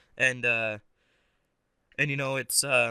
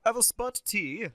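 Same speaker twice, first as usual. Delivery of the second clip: high-pitched mock British; hammed-up British, pitched up; British accent; 7 semitones higher than usual. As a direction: put on a prim, bad British accent. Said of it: "if I can do British".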